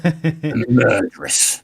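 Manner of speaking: slow, drawn-out dramatic accusation. Acted. Player accusing the suspect, slow and theatrical. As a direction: savour each word like a detective.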